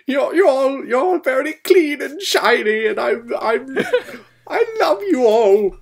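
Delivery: very high, gushing put-on voice; hammed-up high-pitched adoration. Rescued engine to the clean engines, gushing love.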